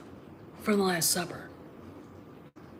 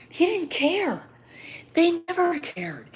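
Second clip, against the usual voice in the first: worked-up rant; 10 semitones higher, 3 dB louder than usual. Real, not acted. To the audience, insisting the stars never cared, fired up. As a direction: pitch jumps way up, indignant and emphatic. Ranting about stars not caring.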